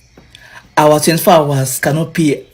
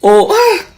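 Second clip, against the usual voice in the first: exasperated cry; 5 dB louder than usual. Real, not acted. Exasperated outburst.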